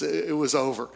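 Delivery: ordinary talk, nothing marked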